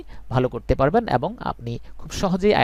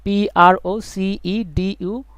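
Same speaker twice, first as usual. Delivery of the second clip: spelled out slowly; 4 semitones higher, much slower than usual. Shown as a demonstration. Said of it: "উচ্চারণ কি করি".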